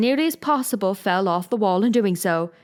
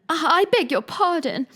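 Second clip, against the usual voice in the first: higher, startled apology; dismay; 10 semitones higher than usual. A narrator's reading. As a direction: blurt the apology out in sudden alarm. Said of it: "she added in dismay".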